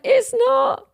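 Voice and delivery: high, loud tearful wail. Retold or imitated. To her husband while he hugs her, tearful wail. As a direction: cry the refusal out. Retold crying while being hugged.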